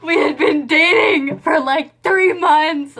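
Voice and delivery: a touch of tearful indignation; tearful outrage. Real, not acted. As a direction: let the hurt crack through. Heartbroken recalling the breakup.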